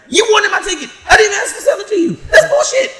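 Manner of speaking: high, loud, laughing mock yell at her; half-laughing, throw the blame back at her loudly; retold angry yell. Retelling the ticket fight with her, a laughing high yell.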